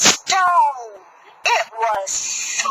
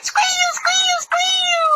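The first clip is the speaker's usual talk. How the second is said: very high squealing pig cry; much slower than usual. Acted. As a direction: squeal like a frightened piglet. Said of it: "the little pig went".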